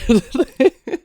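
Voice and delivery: high, uncontrollable giggle; laugh. Real, not acted. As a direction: burst of helpless laughter. Cracks up on first word.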